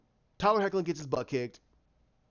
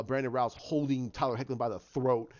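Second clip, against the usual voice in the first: normal talking voice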